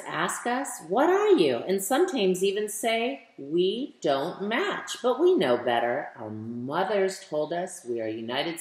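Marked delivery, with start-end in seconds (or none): none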